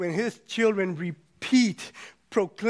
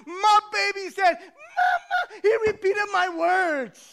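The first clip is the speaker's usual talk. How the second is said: very high, crowing excitement; ecstatic mother; 12 semitones higher than usual. Acted. Mother bragging that baby repeated the word, ecstatic and high. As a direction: crow it like a thrilled mom. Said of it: "we get all excited".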